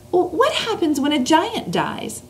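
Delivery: put-on a light, lifted girl's voice; little girl voice. Reads Sophie's lines.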